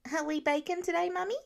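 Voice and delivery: put-on a small kid begging to bake; little boy asking his mum about baking; eager little boy. A narrator's reading. Child Nate voice.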